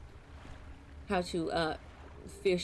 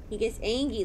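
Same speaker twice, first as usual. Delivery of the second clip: normal talking voice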